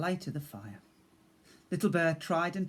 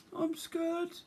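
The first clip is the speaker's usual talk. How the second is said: high small timid voice; small scared bear cub. Acted. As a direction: speak as a frightened little cub.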